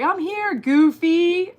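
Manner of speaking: high, teasing singsong; imitating a friend piping up to announce herself; playful announcing. Acting out Marsha announcing herself.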